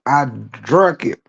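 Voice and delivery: ordinary talk, nothing marked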